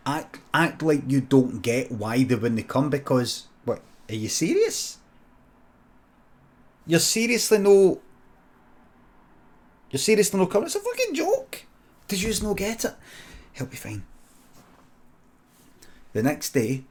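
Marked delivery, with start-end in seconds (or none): 3.6-10.7 high, loud fake outrage; mock disbelief. Friend feigning outrage at cousin staying away. 10.7-12.9 very high, squeaky disbelief; mock incredulity. Friend mocking cousin for missing the joke, squeaky disbelief.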